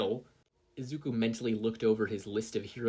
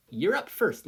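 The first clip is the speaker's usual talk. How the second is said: teasing teacher; 8 semitones higher, 6 dB louder than usual. Acted. Midnight teasing.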